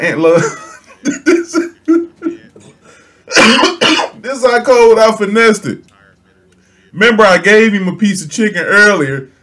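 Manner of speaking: laughing hard while talking high; words squeezed out through laughter; cracking up mid-story. Real, not acted.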